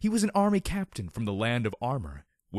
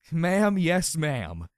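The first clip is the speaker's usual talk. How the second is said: suppressed giggle; 5 semitones higher, 4 dB louder, much slower than usual. A narrator's reading. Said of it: "struggling to keep a straight face".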